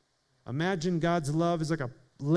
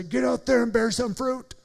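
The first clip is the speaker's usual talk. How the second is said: mock pushy command; 6 semitones higher than usual. Mock command Jesus doesn't give.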